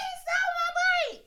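put-on high, loud childish cry; retold wail. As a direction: wail it like a kid telling mom. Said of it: "I started screaming".

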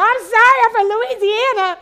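high, loud flustered apology; panicked sorry to honking drivers, high and loud. Retold or imitated. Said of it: "I was like".

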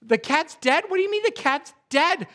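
high, loud, incredulous "What?"; can't believe what he just heard; shocked disbelief. Retold or imitated. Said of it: "The man was shocked, like".

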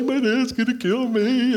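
mock crying. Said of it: "crying the whole way up there".

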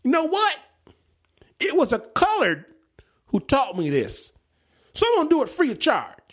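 high-pitched, loudly declaring farmhand; old farmhand talking loudly; loud announcement. Retold or imitated. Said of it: "he says to my father loudly".

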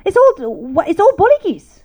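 high-pitched telling-off of her son; scolding mom. Retold or imitated. Mom waking Josh over his messy suitcase, scolding.

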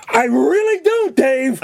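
high, slow mock protest; neighbor's outburst. Neighbor arguing with Dave in the garage, high hammed-up protest. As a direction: protest to a housemate in a squealed impression. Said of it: "one guy would go".

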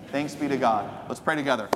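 ordinary delivery

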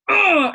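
loud strained grunt; effort grunt. Retold or imitated. Doctor straining at the exam, loud effortful grunt. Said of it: "he starts sort of going".

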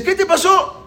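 high-pitched acted astonishment; shocked question. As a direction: blurt it out, voice leaping up. Ve al vecino lleno de sangre. (pregunta asombrada, voz aguda)

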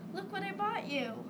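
high-pitched, eager announcement; excited. A narrator's reading. Ralph presents a gift to his brother, excitedly. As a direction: show off the gift you bought.

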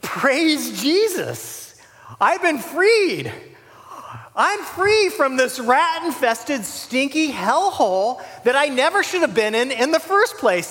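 imagined jubilant thoughts. Pretend. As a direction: gush with relief at being freed. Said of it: "And I'm thinking".

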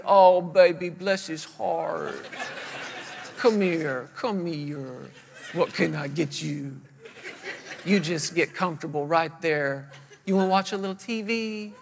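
slow, low, tender cooing; baby the sick child softly, hammed up; syrupy mock mommy comfort; mock coddling mother. Imagined coddling mother, imitated.

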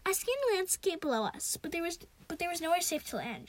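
plain reading voice

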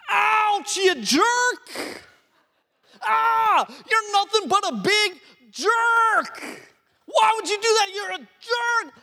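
high, loud hammed-up outraged shout; mock angry dad. Pastor dad yelling at his son after the welt, mock furious. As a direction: yell like a stung dad. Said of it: "angry, upset in that moment".

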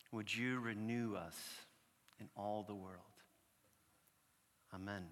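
slow, hushed prayer with long pauses. Acted. Pastor leading the congregation in prayer, slow and hushed.

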